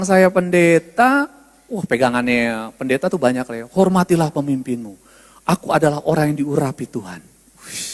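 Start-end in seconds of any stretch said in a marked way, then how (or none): 3.7-7.2 low, hushed mock solemnity; mock-holy low pronouncement; pompous pastor. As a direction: intone it with grave self-importance. Mocks self-important pastor quoting rank.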